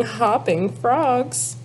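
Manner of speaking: plain reading voice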